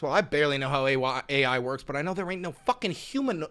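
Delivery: ordinary delivery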